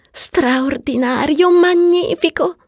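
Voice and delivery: high, slow, swooning praise; drawn-out dreamy ecstasy; rapturous. Acted. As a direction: savour each word in a high voice. Marion rhapsodises about her experience.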